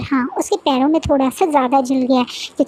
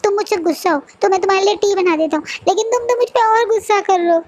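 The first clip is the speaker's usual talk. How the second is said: high tearful whine, words breaking; a wounded boy crying while he complains; 7 semitones higher than usual. Acted. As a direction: sob it out like a hurt child. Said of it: "रोते बोलता है".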